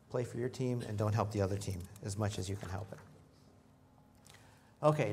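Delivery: normal talking voice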